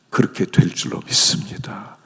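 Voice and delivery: whispered confession of faith. Real, not acted.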